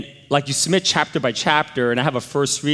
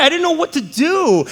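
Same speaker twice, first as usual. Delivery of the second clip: usual speaking voice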